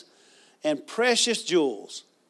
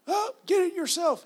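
cartoon grump; 12 semitones higher than usual. Voices Yosemite Sam in cartoon.

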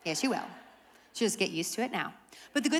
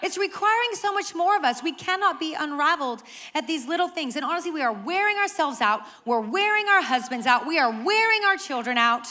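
loud, high preaching build; fervent sermon climax; full-force preaching; 6 semitones higher, 6 dB louder than usual. Acted. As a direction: drive it home to the congregation.

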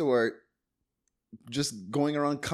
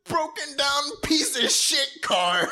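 high, slow, weepy wail; hammed-up overjoyed sobbing imitation; mock-tearful gratitude; slower than usual. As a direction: cry thanks as if given a second life. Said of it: "and he's like".

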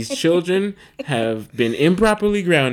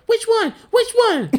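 put-on high, excited child voice; imitating an eager kid; 3 dB louder than usual. Dad voicing his youngest asking which word: high, eager child voice. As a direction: ask it up high like a curious little kid. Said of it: "Sinai is like".

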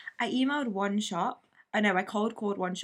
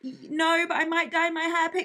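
high, flustered awkward reply; dodging a creepy question, high and flustered; awkward deflection; 9 semitones higher, 3 dB louder than usual. Retold or imitated. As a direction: blurt it out nervously. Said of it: "I might have been like".

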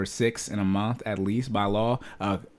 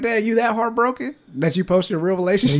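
high-pitched teasing question; retold incredulous needling, pitched way up; mock disbelief; 11 semitones higher, 4 dB louder than usual. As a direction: rib a friend in a raised, put-on voice. Teasing heartbroken friend, retold.

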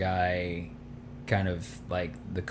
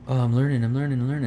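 mocking naive rookie; 6 semitones higher, 6 dB louder, much faster than usual. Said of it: "but it was like, you know".

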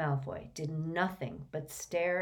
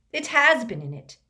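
loud, higher vehement protest; boy hotly insisting to Dumbledore; vehemently; 6 semitones higher, 9 dB louder than usual. A narrator's reading. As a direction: hit has hard, defensive. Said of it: "said Malfoy vehemently".

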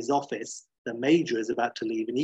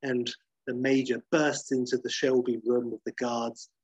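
ordinary talk, nothing marked

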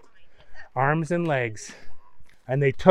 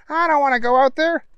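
high, quick comic voice; reluctant henchman voice; 11 semitones higher, 3 dB louder, faster than usual. A narrator's reading. Bad guy refusing to go out, high reluctant henchman. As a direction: balk in a squeaky little voice.

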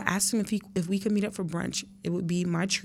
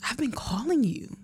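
breathy hushed whisper, pitched up; stunned whisper; 6 semitones higher than usual. Retold or imitated. Daughter finally facing her absent father, whispering in shock. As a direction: barely get the words out, in shock.

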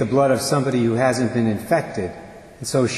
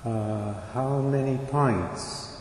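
hesitant question; 6 dB quieter, much slower than usual. Acted. Father stunned, asking doctor.

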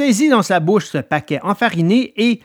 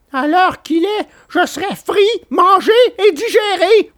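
much higher, scared puppet voice; frightened puppet; 3 dB louder than usual. A narrator's reading. Pinocchio answering Alidor, high frightened voice. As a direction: shudder at the thought of being late. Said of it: "qui tremblait encore de peur".

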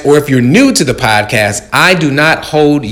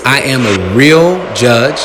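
normal talking voice

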